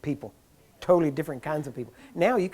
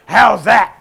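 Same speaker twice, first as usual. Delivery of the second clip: booming, shouted demand, pitched up; imitating Jack Coe; 4 semitones higher, 14 dB louder than usual. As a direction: fire it out like a rough healer. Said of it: "he grabs me and goes".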